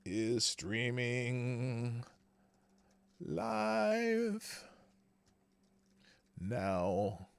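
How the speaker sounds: very slow, drawn-out, absent-minded words; typing along. Real, not acted. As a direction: stretch each word out while typing it. Reading aloud while typing tweet.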